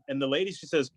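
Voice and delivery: usual speaking voice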